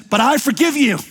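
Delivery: hammed-up generous judge forgiving the thief; kindly judge. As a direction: let the judge sound magnanimous. Judge pardons the car thief.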